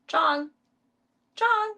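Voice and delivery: high, loud call. Real, not acted. Calling the sleeping cat Chong, high and loud. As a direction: shout the name up high.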